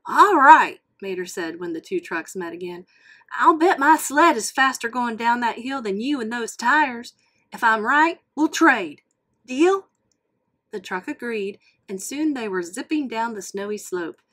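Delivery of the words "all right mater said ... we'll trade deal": Mater voice. Acted. Mater challenging the truck to a race, loud. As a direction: play the eager tow truck, loud and bright.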